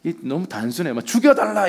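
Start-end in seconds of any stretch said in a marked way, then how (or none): 1.1-1.7 high-pitched, indignant outburst; hammed-up cry of exasperation; mock angry demand. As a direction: imitate a petulant demand to die. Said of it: "또 막 화를 내요".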